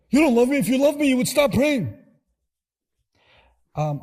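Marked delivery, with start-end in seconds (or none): 0.1-2.0 angry parent. Retold or imitated. Parent yells at praying child.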